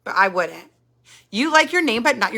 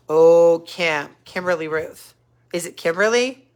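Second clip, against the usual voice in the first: ordinary talk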